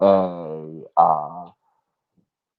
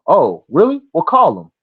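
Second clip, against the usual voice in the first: raised, loud, prodding quote; imitated challenge; 9 semitones higher, 6 dB louder than usual. Ron daring him to phone his dad, prodding. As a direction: act out calling someone's bluff.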